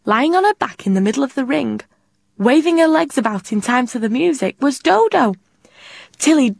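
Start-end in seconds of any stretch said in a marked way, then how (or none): none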